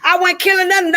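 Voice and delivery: normal talking voice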